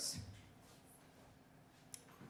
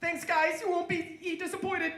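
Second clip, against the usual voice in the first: high, louder, stumbling gratitude; thrilled actor; 8 semitones higher, 6 dB louder than usual. Acted. Recruited actor thanking the FBI agents, high and thrilled. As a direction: gush thanks in an excited raised pitch.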